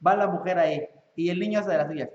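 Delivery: ordinary delivery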